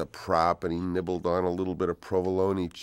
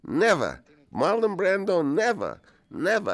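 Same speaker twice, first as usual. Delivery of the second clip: incredulous refusal; 13 semitones higher, 4 dB louder than usual. Retold or imitated. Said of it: "he says".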